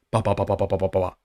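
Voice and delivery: low, soft dismissive blah-blah; mock babble. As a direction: brush off the rest as empty filler. Mocking his apology wording.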